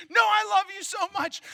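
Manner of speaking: big brother insisting to the wary kid, hammed-up earnest; mock earnest protest. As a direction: plead your innocence a bit too hard.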